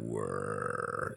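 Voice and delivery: very low, soft rumble; deep voice. Pretend. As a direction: drop it way down low. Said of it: "your voice sounds a little lower".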